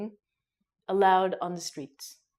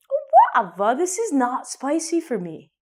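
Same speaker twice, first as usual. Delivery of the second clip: loud, higher, dismissive bragging; boastful friend; 5 semitones higher, 5 dB louder than usual. Retold or imitated. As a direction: show off that it's nothing. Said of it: "your friend is eating it and goes".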